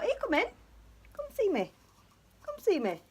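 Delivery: high sing-song coaxing, pitched well up. Real, not acted. Owner coaxing her birds out of their cage, high cooing. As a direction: coo at the birds to lure them out.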